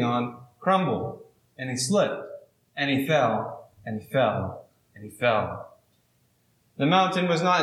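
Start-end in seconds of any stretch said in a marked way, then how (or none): 1.6-5.8 repeated "fell" fading as he plunges; falling cadence. Acted. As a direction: let every "and he fell" sink further. Storyteller dramatizes long fall.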